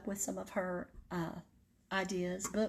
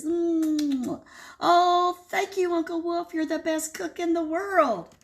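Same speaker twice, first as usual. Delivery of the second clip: much higher, louder chick voices; baby chicks; 8 semitones higher, 6 dB louder, slower than usual. Acted. Baby chicks thanking Uncle Wolf, high and squealy. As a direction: squeal it like happy little chicks.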